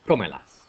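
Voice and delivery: "Cómelas" with firm stress on the first syllable, CÓ; stress third from end. Shown as a demonstration. Teacher practicing accent placement after counting back, CÓ stressed in "Cómelas". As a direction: hit CÓ in "Cómelas", then let the rest fall. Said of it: "we're gonna put a little accent".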